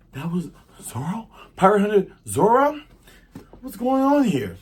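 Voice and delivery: hushed start rising to loud disbelief; Nami. Acted. Thief realizes who the crewmate is, mounting high astonishment. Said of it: "Nami's like".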